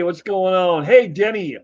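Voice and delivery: normal talking voice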